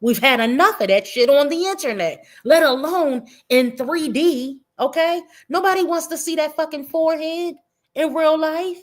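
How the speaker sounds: heated roast. Real, not acted. Roasting the troll, worked up.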